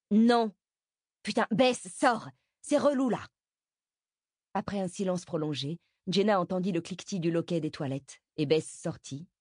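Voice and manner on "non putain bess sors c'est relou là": high, louder, fed up; exasperated demand. Acted. Jenna tells Bess to come out, exasperated. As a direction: order her out, voice raised in pitch. Impatient demand through toilet door.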